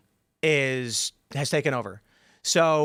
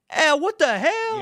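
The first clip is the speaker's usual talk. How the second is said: high, slow, stretched-out disbelief; dragged-out high what the hell; shocked outburst; 14 semitones higher, 4 dB louder, much slower than usual. Retold or imitated. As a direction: squeak it out like a stunned customer. Guy shocked by AI drive-thru bill.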